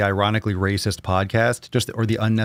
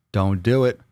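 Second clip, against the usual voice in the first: usual speaking voice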